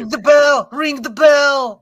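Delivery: loud, high holler; shouted plug. Real, not acted. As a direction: belt it out to the audience. Calling out to viewers.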